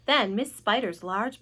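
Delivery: plain reading voice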